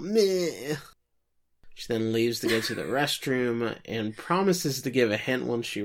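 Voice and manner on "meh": bratty meh with tongue out; tongue-out raspberry. Retold or imitated. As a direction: stick out your tongue and go meh. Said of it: "sticks her tongue out".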